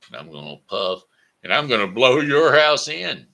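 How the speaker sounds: loud wolf threat bursting out. Acted. Wolf threatens third house.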